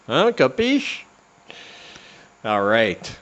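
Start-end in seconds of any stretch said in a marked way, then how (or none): 0.1-1.0 high playful Capiche; mock mobster. Podcaster teasing listeners to subscribe, mock mobster. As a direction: tease the listener like a wiseguy. Playful tough-guy check-in.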